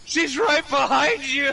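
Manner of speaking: retold warning shout. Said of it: "I'm like".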